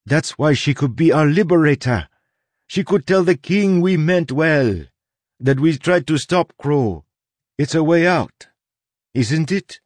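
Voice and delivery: high-pitched, hopeful appeal; pleading. A narrator's reading. Soleil begs Tress to be their liberator, pleading. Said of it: "pleading in her eyes".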